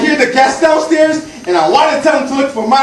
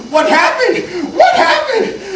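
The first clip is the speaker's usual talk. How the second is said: high imitated yells, climbing higher; mock yelling; 11 semitones higher than usual. Guests walk in and demand answers, high mock yell. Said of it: "they're yelling at me".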